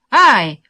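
sharp loud cry of "ouch"; loud yelp. A narrator's reading. Prisoner distracting the guard, loud yelp. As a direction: cry out loudly as if stepped on. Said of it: "один из заключённых громко вскрикнул".

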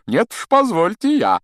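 high, loud interruption; officious Lockhart. Acted. Teacher butting in to deal with the snake, officious and high.

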